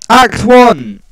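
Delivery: high, very loud announcing cry; shouted title announcement. Acted. Player announces the game's first act, high loud shout. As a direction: belt out the title like a big reveal.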